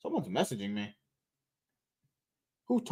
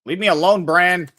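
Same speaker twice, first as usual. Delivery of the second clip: loud yell; 3 semitones higher, 8 dB louder than usual. Real, not acted. Gamer yelling at opponent.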